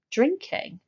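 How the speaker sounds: usual speaking voice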